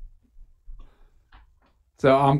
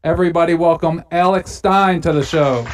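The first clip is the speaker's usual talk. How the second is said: announcer; 2 semitones higher than usual. Acted. Podcast intro read.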